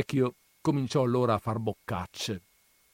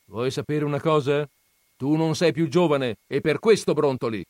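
lightly voiced grandmother, raised and quicker; teasing grandmother; 6 semitones higher, 7 dB louder, faster than usual. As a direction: needle him knowingly. Grandmother jabs. (nonna che punzecchia il marito, voce alzata)

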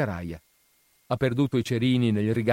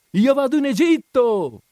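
high, loud cry, stress on vado; swallow's shout; 13 semitones higher, 7 dB louder than usual. A narrator's reading. Swallow announcing to the harbour, shouting. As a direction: call out proudly to nobody listening. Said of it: "gridò il rondinino".

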